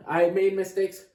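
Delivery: normal talking voice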